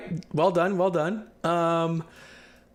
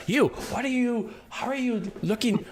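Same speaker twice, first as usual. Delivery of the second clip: searching voice; 4 semitones higher, 4 dB quieter than usual. Acted. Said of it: "her voice trying to move around".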